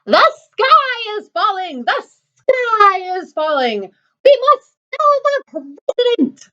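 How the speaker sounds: very high, slow-stretched hen squawk; panicked chicken clucking. Acted.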